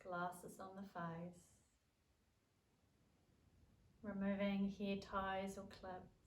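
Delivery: calm yoga nidra guidance with long pauses; guided relaxation. Acted. Leads a yoga nidra practice.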